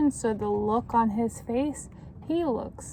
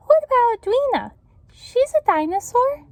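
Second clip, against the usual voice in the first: put-on high, fast, louder eager kid voice; child character; 13 semitones higher, 7 dB louder than usual. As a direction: pipe up like an eager classmate. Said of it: "Beth McFeeder asked".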